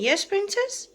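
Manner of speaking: high, loud cooing question; pet talk. Real, not acted. Owner checking with her sick princess, high loud pet talk. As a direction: ask the little princess with a big upward lift.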